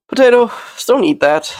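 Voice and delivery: sudden high-pitched call; scolding a pet. Real, not acted. Owner stops cat eating something, high scolding call. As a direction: call the name high, then chide. Calls out to pet mid-talk.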